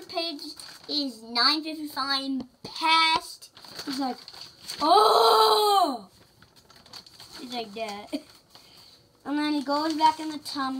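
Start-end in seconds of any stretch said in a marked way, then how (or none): 4.8-6.1 high loud startled cry; shouted oh. Acted. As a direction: blurt it out in shock. Character shouts in book.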